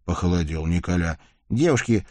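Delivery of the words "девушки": high, fast nervous persuading; nervous coaxing. A narrator's reading. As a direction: sweet-talk them anxiously, high. Nikolya coaxing the rusalkas.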